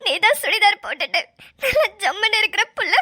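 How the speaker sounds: crying through a painful retelling; upset, weepy, gulping sobs between phrases; sobbing. Acted. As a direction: repeat his words in tears. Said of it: "தேம்பி தேம்பி அழுதுனா".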